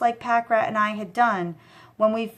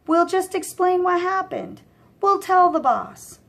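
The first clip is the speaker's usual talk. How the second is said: higher, louder exasperation; exasperated Mikey; 10 semitones higher, 4 dB louder than usual. A narrator's reading. Mikey fed up with his partner, higher and louder. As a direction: snap at him to stop panicking.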